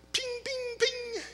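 high sharp ping sounds; rifle pings. Retold or imitated. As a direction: mimic small rifle shots. Imitating rifle shots.

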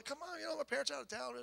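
lightly voiced girl luring him over; coaxing girl.

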